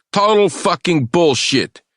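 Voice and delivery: loud, high and slow, every word hammered; the bad cop tries to scare the captive; furious shout. A narrator's reading. Bad cop interrogation outburst.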